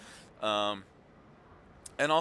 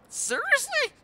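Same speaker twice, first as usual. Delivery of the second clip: squeaky, disbelieving Seriously; a jokey high-pitched child's Seriously; high incredulous squeak; 4 dB louder, slower than usual. Retold or imitated. Daughter quoted in story.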